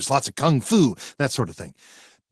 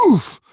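pained oof; 12 semitones higher, 6 dB louder than usual. Acted. Hit by a chair.

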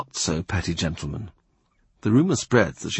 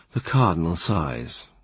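usual speaking voice

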